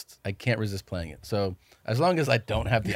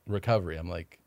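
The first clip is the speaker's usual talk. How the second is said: ordinary delivery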